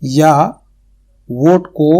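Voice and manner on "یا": ordinary talk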